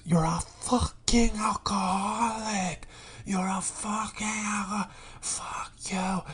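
high, strained scream held down in volume; imitated furious woman. South Bronx neighbor cursing out her partner, imitated fury. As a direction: drag out a hushed, raspy mock shriek of fury. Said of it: "the fury and passion of a hundred South Bronx women".

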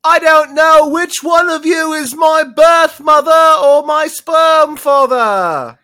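high, loud anguished cat shout; dramatic anguished cry. Acted. The Cat in the Hat shouting about his parents, high and loud. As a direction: wail it like a soap opera reveal. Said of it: "And he's like".

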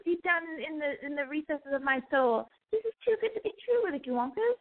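very high, hammed-up English accent; mock English accent. Podcaster playing the boy confessing his love, hammed-up English accent. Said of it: "I'm not saying his accent is like that".

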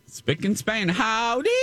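high, folksy Southern twang; chirpy country comedienne voice; Minnie Pearl impression. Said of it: "That's a good Minnie Pearl right there".

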